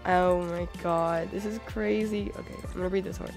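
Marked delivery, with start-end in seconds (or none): none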